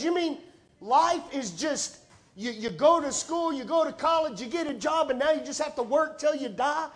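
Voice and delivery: high, loud, disbelieving daughter; incredulous daughter. Retold or imitated. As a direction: pitch it up as the grown daughter who can't believe it. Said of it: "She goes".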